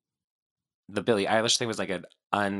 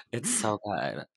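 ordinary talk, nothing marked

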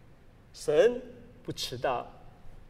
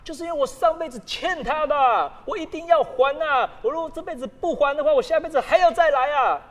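anxious believer; 7 semitones higher, 6 dB louder than usual. Retold or imitated. Mimicking a believer's fear of rebirth.

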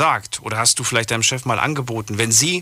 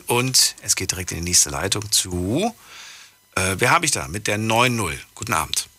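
ordinary delivery

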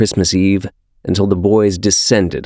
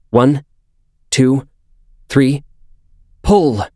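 counting out; 6 semitones higher, slower than usual. Acted. Counting off a group pull.

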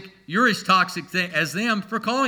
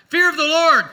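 louder and higher, with a laugh; excited exclamation; 10 semitones higher, 7 dB louder than usual. Real, not acted. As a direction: burst out laughing on it. Laughing exclamation after joke.

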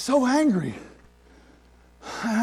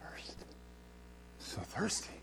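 whisper; 3 semitones lower, 19 dB quieter, slower than usual. Real, not acted. Sermon climax on spiritual thirst.

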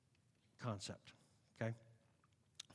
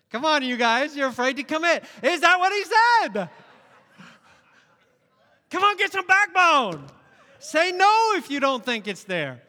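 high, loud preacher's exhortation; preacher daring the congregation to speak up, loud and high; loud challenge; 8 semitones higher, 10 dB louder than usual. Real, not acted. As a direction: push the congregation hard to answer.

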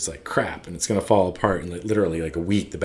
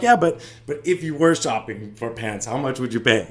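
higher, insistent mock sales pitch; coaxing retold salesman; 4 semitones higher than usual. Tailor pushing a customer to name a price, coaxing. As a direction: imitate a tailor who keeps pushing.

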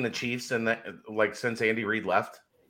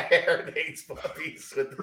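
words through laughter; laughing; 5 semitones higher than usual. Real, not acted. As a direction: laugh while saying it. Laughing while speaking.

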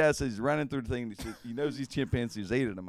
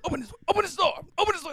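high frantic yell at the locked door; panicked pleading yell; 5 dB louder, much faster than usual. Retold or imitated. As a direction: shout it high, begging to be let in. Locked out, chimps coming.

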